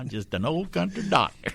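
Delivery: Southern country doctor. Retold or imitated.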